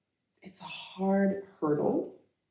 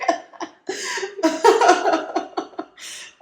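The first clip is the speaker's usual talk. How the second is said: high, loud burst of laughter; long, real belly laugh; 13 semitones higher, 5 dB louder than usual. Real, not acted. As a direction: crack up for real. Laughs at feeling badass.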